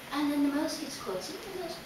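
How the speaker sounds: soft, low storytelling that creeps along; hushed suspense. A narrator's reading. Storyteller as the mouse creeps toward the cat's tail, hushed suspense. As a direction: draw listeners in with a hush.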